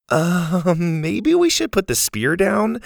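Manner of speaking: nervous. A narrator's reading. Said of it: "Zeta said nervously".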